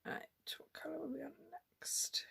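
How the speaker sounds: quick whisper to herself. Real, not acted. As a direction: whisper it fast under your breath. Muttering to herself while crafting.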